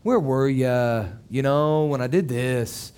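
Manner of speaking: slow, drawn-out gentle coaxing; gracious. Acted. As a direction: coax a friend patiently, letting words stretch and sink. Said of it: "if he was gracious".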